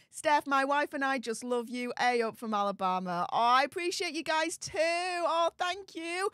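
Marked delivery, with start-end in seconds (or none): none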